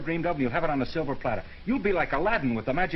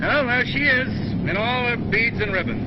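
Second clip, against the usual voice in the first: excited; 10 semitones higher, 5 dB louder than usual. Acted. Excited view of New York.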